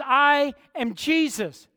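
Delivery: loud, high, slow divine declaration; Jesus thundering his name; Jesus proclaiming. Acted. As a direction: announce yourself as the Lord, booming. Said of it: "He says".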